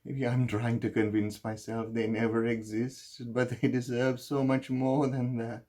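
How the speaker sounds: loud, high-pitched outpouring of guilt; anguished confession. Acted. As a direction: pour out the guilt at full emotional pitch. Guilt-ridden confession at its emotional peak.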